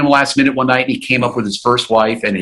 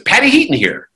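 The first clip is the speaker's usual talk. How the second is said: lightly imitated high, brisk phone introduction; Patricia Heaton; 10 semitones higher than usual. Caller announcing herself to Doug, imitated high voice. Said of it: "She goes".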